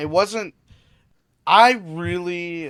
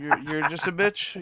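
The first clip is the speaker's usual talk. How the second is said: normal talking voice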